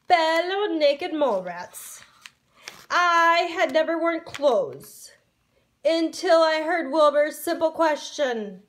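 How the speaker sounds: lightly voiced grand old ruler; booming warrior king. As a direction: proclaim it to the colony.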